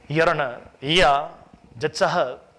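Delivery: usual speaking voice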